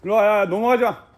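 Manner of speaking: loud call to a dog. Real, not acted. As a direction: shout for the dog to come back. Calling the dog Dollar.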